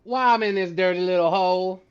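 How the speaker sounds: loud, high mock Southern wail; hammed-up Southern belle lament. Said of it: "it sounds like a straight up Southern belle".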